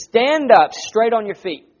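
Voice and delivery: loud yell. Acted. Preacher as Paul calling to a man in a crowd, loudly. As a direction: call it out so the whole crowd hears. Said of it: "he yells out in a loud voice".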